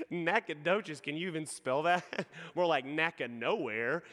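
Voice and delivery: slow teasing through chuckles; mocking laughter. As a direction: imitate people laughing at a town's name. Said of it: "And they start laughing".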